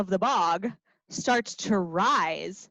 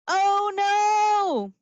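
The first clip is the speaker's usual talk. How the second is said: much higher mock "oh no"; mock alarm; 9 semitones higher, 4 dB louder than usual. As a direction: gasp it out for kids. Song alarm.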